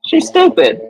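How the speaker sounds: loud, high burst of scorn; exasperated outburst. Real, not acted. Hearing the clip, she bursts out in loud scorn. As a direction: blurt it out, fed up.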